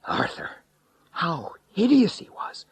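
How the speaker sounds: high horrified cry. A narrator's reading. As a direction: recoil in horror. Horror at the monster.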